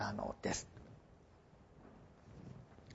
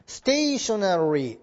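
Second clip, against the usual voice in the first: slow model word; much slower than usual. Shown as a demonstration. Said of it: "発音同じですけど".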